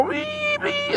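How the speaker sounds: piercing high squeal of a wounded deer. Retold or imitated. Trucker imitates the hit deer screaming at him, piercing squeal. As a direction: shriek it like a hurt animal. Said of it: "He was hollering and cussing me out".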